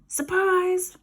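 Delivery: a high gleeful pop on the word; spring the word like a trap; gleeful surprise. Acted. Ned picturing the ambush on his sister, gleeful.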